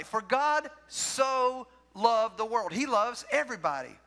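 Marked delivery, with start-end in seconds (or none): 0.0-2.7 slow, stretched-out recital; drawn out verse. Shown as a demonstration. Pastor draws out the verse for emphasis, very slowly. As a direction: stretch every word of the verse.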